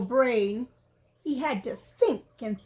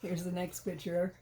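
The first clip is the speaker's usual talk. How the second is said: ordinary delivery, nothing marked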